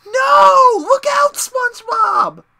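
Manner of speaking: high, loud mock-alarmed shout; full-force yell. Quoting the game manual to friends, yelled at the top of the voice. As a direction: scream it as an urgent warning, way up high. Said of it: "You had to yell".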